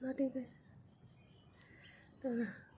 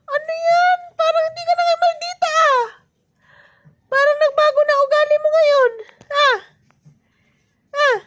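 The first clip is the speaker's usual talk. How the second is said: high-pitched mock questioning; teasing; 6 semitones higher, 4 dB louder than usual. Real, not acted. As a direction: needle a little one in a high, raised voice. Teasing bebe about changed temper.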